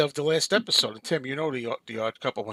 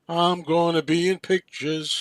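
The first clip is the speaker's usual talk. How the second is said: slow, higher-pitched starry-eyed announcement; drawn-out, raised quoted voice; acted movie line; 4 semitones higher, 4 dB louder, slower than usual. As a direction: proclaim it slowly like a character's big line. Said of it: "Then he goes".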